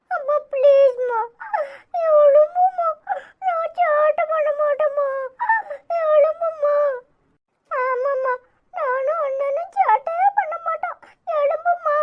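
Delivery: put-on high, tearful child's pleading wail; little child crying over his mother. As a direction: sob and beg mother to wake up like a small child. Children beg unconscious mother.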